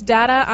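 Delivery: "data" with the A of "cat"; short-A data. Real, not acted. Co-host reading the headline, saying data as DAT-uh. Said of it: "Data or data?".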